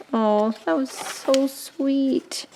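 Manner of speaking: soft, high, slow touched coo. Real, not acted. To the giver of an Arches pad, touched. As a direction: draw it out with tender gratitude.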